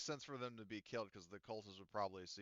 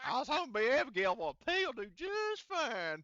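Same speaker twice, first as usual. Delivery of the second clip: high, loud hammed-up yokel; randy yokel; 13 semitones higher, 10 dB louder than usual. Yokel excited about the pig, high mock whooping. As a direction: crow it like a lusty comic character. Continues eager pig-loving act.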